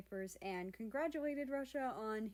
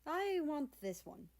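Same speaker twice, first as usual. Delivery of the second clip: put-on higher, lightly Russian-accented statement; 7 semitones higher than usual. Russia deciding to adopt the cat, light Russian accent. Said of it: "spoke with a heavy Russian accent".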